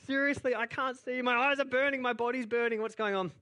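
high, loud desperate plea; begging them to take it seriously; desperate pleading. Retold or imitated. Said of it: "He's like".

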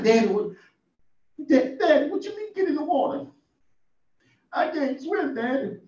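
put-on high, pleading child's voice; scared little boy. The boy pleading with his daddy, high and scared. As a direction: beg your daddy like a frightened kid. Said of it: "I'm gonna be crying".

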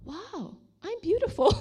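high-pitched marveling at oneself; wonderstruck, as if just discovering it; delighted little realization, voice lifted high; amazed self-admiration. Retold or imitated. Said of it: "I think to myself".

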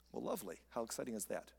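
low, very soft deadpan sarcasm; dry sarcasm. Real, not acted. Preacher reacting to Job being told he deserves worse, drily sarcastic. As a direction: drop it into a dry, quiet aside.

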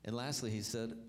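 ordinary talk, nothing marked

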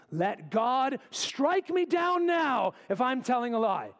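high, drawn-out shout to heaven; full-voiced, defiant self-curse; cursing and swearing. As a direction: bellow the oath, peaking mid-phrase. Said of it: "That's the type of cursing and swearing".

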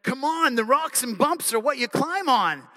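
put-on boy calling back to his sister, eager; excited little boy. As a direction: bounce it up like an eager kid brother. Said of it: "looks at his sister and says".

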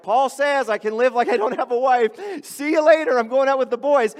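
breezy mock husband, calling out as he leaves; mock carefree husband. Husband excusing a Saturday night out, mock carefree.

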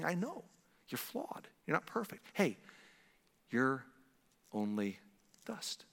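very soft, forgiving reassurance; understanding. Acted. God the Father to flawed children, softly understanding. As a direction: say it hushed, with gentle pauses. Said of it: "He's not excusing, dismissing the, the seriousness".

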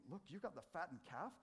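put-on high, fast, sulking complaint of the good kid; whiny older son. Preacher voicing the jealous older brother, whiny and high. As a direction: whine it like a kid cheated of his party. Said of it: "This is like".

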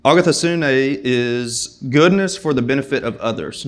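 ordinary talk, nothing marked

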